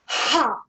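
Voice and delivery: short loud high Ha on the exhale; shouted Ha. Shown as a demonstration. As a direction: push out a sharp shouted Ha. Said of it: "But so breathe in, and then as you exhale".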